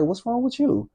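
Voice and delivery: high-pitched imitation of a puzzled mom; concerned mother. Mom finding her child crying in the living room, high-pitched concern.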